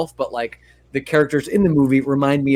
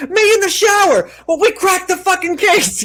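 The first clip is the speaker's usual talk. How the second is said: far higher, hammed-up mocking voice; mock Vinny. Vinny mocking her photos, hammed-up high mockery. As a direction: sneer it in a cartoonish mock voice.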